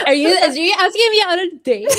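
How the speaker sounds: high-pitched question through laughter; laughing tease. Real, not acted. Friend teases about being asked on a date, laughing. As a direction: crack up while asking, words tumbling out fast.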